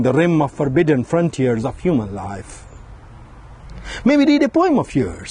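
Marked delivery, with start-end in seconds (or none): 4.0-5.3 party guest. Retold or imitated. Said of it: "someone said".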